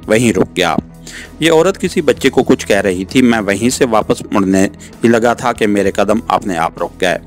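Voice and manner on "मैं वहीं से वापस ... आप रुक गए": ordinary delivery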